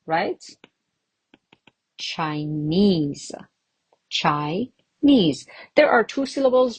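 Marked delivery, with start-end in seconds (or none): 2.0-5.5 Chinese, sounded out with NESE stressed; teacher's model of chiNESE; stress on last syllable. Shown as a demonstration. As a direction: split Chinese in two and stress the end. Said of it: "but still on the last one".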